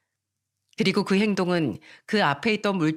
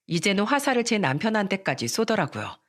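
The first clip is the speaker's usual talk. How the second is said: ordinary delivery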